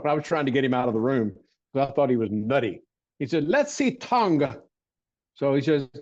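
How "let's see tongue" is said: silly voice. Retold or imitated. Recalling the barefoot doctor at his bedside, in a higher silly voice. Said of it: "the silly voice I'm doing".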